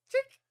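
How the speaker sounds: loud, high-pitched "tick"; camera click imitation. Player imitating the camera snapping the ghost, loud high click. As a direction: pop out a sharp camera click.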